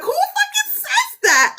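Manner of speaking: very high, slow, laughing disbelief; incredulous shriek. Retold or imitated. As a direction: squeal it out in shock. Mocking the book's slang.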